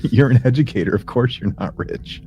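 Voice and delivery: ordinary delivery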